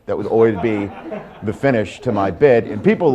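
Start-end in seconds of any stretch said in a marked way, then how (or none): none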